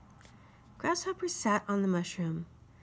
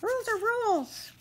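fussy mosquito. Acted. Mosquito insists on the ferry rule, high and officious. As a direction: lay down the law like a tiny self-important official.

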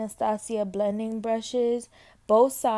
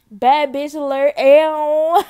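excited; 5 semitones higher, 11 dB louder, slower than usual. Real, not acted. Showing off finished eye.

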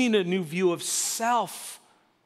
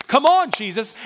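loud, higher-pitched urging from a father; impatient Jairus; 4 semitones higher, 8 dB louder than usual. Acted. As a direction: hurry him along, exasperated. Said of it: "like, impatient father moment".

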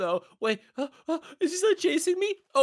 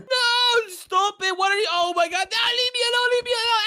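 higher, panicked pleading; scared screaming; 5 semitones higher, faster than usual. Real, not acted. Boy cornered by tiger at his base, scared screaming. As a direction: yell in fright to be left alone. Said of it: "he screamed again".

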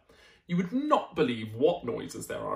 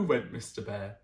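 ordinary delivery, nothing marked

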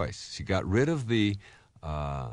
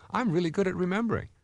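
much higher, bright "I'm really good at remembering"; upbeat new self; 10 semitones higher than usual. Shown as a demonstration. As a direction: lift the voice with new confidence.

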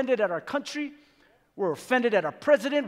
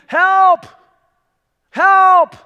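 very loud, high desperate yell; crying and yelling; 9 semitones higher, 13 dB louder than usual. Acted. Stranded man yelling for anyone to rescue him, desperate shout. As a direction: cry out for help at full voice. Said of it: "starts crying and yelling".

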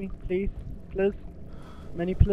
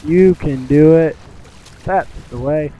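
cheering coach quote; 11 dB louder than usual. Retold or imitated. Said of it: "Sorry, references".

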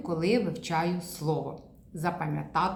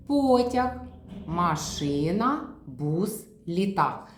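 slow word list; slower than usual. Shown as a demonstration. Therapist reading the picture row, very slow машина. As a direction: read the row slowly, one by one. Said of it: "Перевернули знову".

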